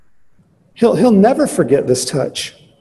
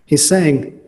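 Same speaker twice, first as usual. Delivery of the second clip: normal talking voice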